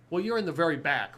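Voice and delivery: usual speaking voice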